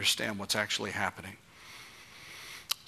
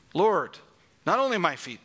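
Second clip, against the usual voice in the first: higher and louder eager plea; eager outburst; 5 semitones higher, 5 dB louder than usual. Acted. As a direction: Peter blurting out his whole-hearted yes. Peter's eager response.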